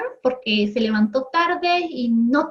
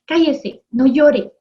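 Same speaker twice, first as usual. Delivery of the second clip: mock-stern order, a bit louder; stern hushing; 2 semitones higher, 5 dB louder, slower than usual. Adult ordering a crying child to stop, mock stern. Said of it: "Si un niño está llorando".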